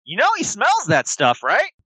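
high, sweet toy-animal voice; childlike plush friend. Acted. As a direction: speak like an eager stuffed elephant bear. GM voices Billy Bucks.

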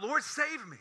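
high-pitched acted cry for help; dramatized desperate plea. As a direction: call out as if sinking. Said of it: "he cried out".

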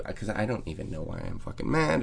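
normal talking voice

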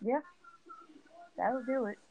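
ordinary delivery

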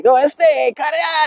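high, cartoon Mexican accent, way up; hammed-up cartoon Mexican accent, pitched high; cartoonish Speedy Gonzalez. Old dealer Billy talking to the young buyer, high cartoon Mexican accent. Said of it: "I did a cartoonish fucking Speedy Gonzalez".

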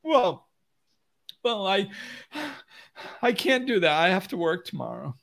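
high, halting mock reluctance; whiny excuse-making. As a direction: imitate a whiner backing out. Acts out someone making excuses.

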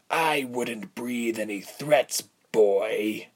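simmering villain rebuke; hot interruption. A narrator's reading. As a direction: cut the boy off with drawn-out menace. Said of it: "the man interrupted hotly".

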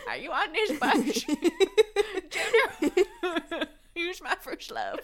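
high, helpless giggling through the line; complete laughing breakdown. Real, not acted. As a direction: try to read on while cracking up. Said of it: "That was a complete breakdown".

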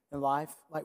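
ordinary delivery

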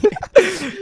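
loud high cackle; high laugh. Real, not acted. Laughs at friend's joke.

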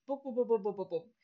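quick soft pu-pu sounds, fading; mock typing sounds. Host imitates the sound of tidying up her notes, softly. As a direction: patter it out like fast work.

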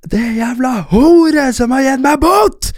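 slow, high-pitched furious-style yell; retold angry shouting. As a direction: re-enact an outburst meant to be overheard. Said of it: "så roper jeg bare".

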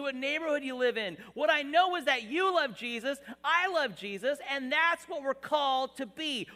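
loud, rising preacher's call; preaching at full force. Acted. Pastor preaching to his church about unity, at full force.

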